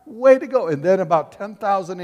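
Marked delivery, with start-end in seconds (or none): 0.0-0.7 raised, louder voice building to a big cheer; acted jubilant commendation; enthusiastic praise. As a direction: proclaim the praise, peaking high and loud on the last words. Acting God's praise in heaven.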